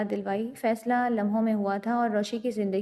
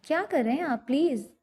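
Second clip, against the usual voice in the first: ordinary delivery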